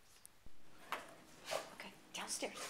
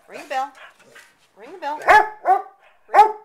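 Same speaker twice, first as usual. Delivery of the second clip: loud command; 6 semitones higher, 31 dB louder than usual. Real, not acted. Training dog to ring bell.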